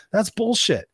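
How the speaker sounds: rally them to go get it; fired-up rant. Real, not acted. Coach firing up listeners to chase their goals.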